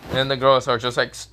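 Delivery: usual speaking voice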